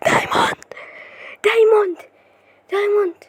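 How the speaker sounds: breathless first, then high loud cry; astonished high exclamation; stunned excitement. Real, not acted. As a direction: cry out the find in amazement. Finally spots a diamond.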